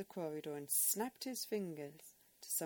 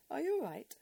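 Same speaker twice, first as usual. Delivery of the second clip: concerned question; 10 semitones higher, 8 dB louder, faster than usual. Acted. Edith checks on sulking Jennifer.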